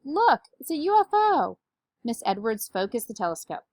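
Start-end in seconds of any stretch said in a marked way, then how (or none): 0.0-1.6 high, loud, stretched-out shout. A narrator's reading. Amelia shouts across the gym at a flying bonnet, high shout. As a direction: shout out a sighting in the sky. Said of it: "Amelia shouted".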